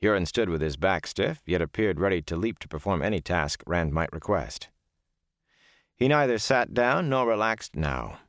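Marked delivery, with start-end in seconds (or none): none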